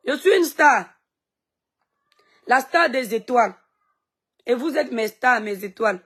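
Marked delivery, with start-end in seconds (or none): 0.1-3.5 proud boast. Real, not acted. Proudly tells her online haters she is a star. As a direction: announce it like a diva.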